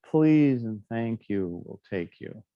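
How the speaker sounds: ordinary delivery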